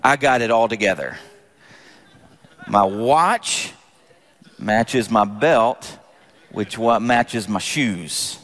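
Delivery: low, slow humblebrag; cool, measured little boast; mock secretive. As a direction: confide it slowly in a very low voice, pausing between items.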